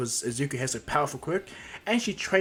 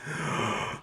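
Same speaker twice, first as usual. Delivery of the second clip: sharp acted gasp; audible startled intake of breath; shocked gasp; 2 semitones higher, 4 dB quieter than usual. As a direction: gasp in sudden realisation. Shock after realisation.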